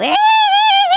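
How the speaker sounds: high, loud villain cackle; sister's evil cackle as she springs a surprise; evil shrieking laugh. Acted.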